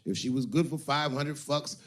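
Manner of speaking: ordinary delivery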